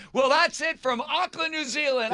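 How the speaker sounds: very high, strained mock shriek; hammed-up TV sign-off. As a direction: sign off like a hysterical TV host. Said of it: "'Cause normally you'd like".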